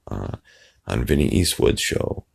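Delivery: normal talking voice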